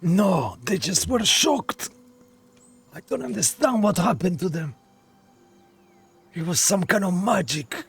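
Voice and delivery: put-on half Russian accent. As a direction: recount the horror with a hint of Russian. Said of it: "half Russian accent now".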